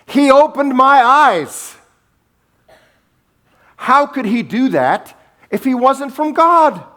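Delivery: forceful, exasperated argument; impassioned protest. Retold or imitated. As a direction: cry it out to make them see, acting the healed man. Healed man argues back forcefully.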